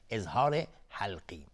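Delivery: ordinary talk, nothing marked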